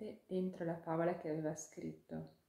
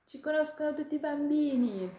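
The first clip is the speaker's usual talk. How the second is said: high, loud tiny-creature voice, bubbling over; a little ladybug who can't contain her joy; thrilled ladybug; 10 semitones higher, 9 dB louder than usual. Acted. Ladybug telling the hawk they're in the fables, high and thrilled. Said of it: "vibrava dall'eccitazione".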